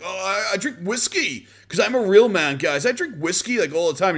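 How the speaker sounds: put-on high, boastful kid faking manliness; mock tough-guy kid. Mocking a teen who claims to drink whiskey, high boast. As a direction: brag like a kid pretending to drink.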